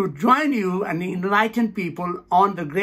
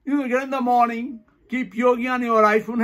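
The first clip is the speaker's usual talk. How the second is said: ordinary talk, nothing marked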